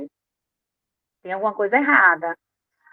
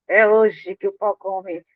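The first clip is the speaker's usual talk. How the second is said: slow menacing threat; slower than usual. Retold or imitated. Imagining confronting a hated person.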